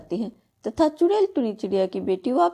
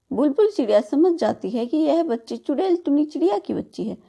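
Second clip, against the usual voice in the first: ordinary delivery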